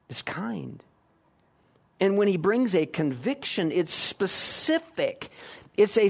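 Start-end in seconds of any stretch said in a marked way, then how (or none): 0.1-0.8 soft whisper. Acted. Shepherd's gentle voice described.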